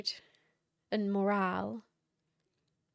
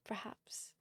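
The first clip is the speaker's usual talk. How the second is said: whisper; 11 dB quieter than usual. Pretend. Teasing about becoming strange.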